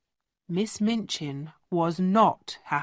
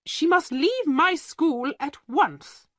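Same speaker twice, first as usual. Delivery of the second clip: higher and slow, hard demand; angry; 8 semitones higher than usual. Acted. Headmistress ordering the penniless girl out, angry and high. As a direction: throw her out in a rage. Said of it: "Miss Minchin said angrily".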